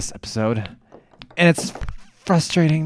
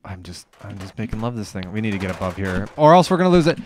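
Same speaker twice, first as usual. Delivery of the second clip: usual speaking voice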